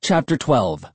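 usual speaking voice

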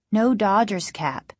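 usual speaking voice

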